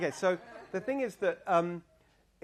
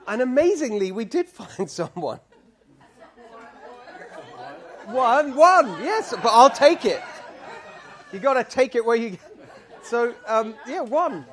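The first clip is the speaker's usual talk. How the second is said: laughing; 7 semitones higher, 8 dB louder than usual. Real, not acted. The whole town gave her one voter.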